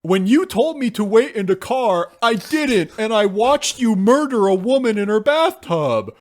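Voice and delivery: high, loud mock-alarmed daughter; daughter confronting dad. Daughter confronting her killer dad, in a high, loud alarmed voice. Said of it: "she's like".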